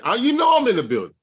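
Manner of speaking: ordinary talk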